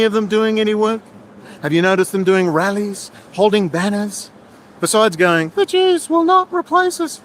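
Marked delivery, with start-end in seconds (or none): 5.6-7.2 high, slow mocking chant; sneering parody of a marcher's slogan; mocking raving idiot. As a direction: mimic a raving lunatic, slow and high-pitched. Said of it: "like a bunch of raving idiot lunatics".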